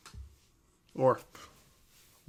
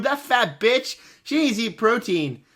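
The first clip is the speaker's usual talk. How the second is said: normal talking voice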